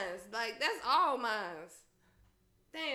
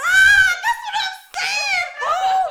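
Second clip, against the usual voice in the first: loud, high laughing yell; excited yell; 18 dB louder, slower than usual. Real, not acted. Yelling to a friend who finally agrees, loud and laughing. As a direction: shout it out, laughing in disbelief.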